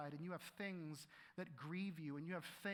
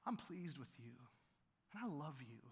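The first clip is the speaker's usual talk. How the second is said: soft, lower loving blessing; tender fatherly voice; 6 semitones lower, 4 dB quieter than usual. Acted.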